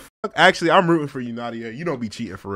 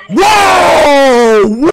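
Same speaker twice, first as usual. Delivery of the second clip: loud high whoa of alarm; startled shout; 13 semitones higher, 18 dB louder than usual. Real, not acted. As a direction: jump back with a loud cry. Reacting to a slur.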